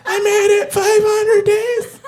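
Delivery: high, slow fake crying; hammed-up tearful; mock sobbing. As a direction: sob it like a movie hero. Mock crying movie character.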